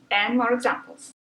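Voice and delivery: usual speaking voice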